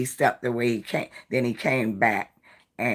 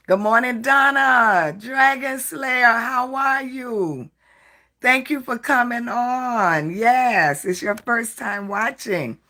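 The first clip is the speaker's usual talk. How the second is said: high, loud welcome, much higher than usual; light up to greet a first-time viewer; squealing hello to a new viewer; excited greeting; 10 semitones higher, 5 dB louder than usual. Real, not acted.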